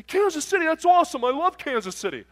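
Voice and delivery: loud, high, gushing outburst; hammed-up trucker enthusiasm; mock excitement. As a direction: imitate a boisterous guy thrilled by news. Trucker excited to hear Kansas City.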